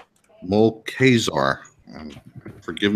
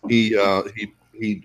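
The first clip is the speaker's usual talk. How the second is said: normal talking voice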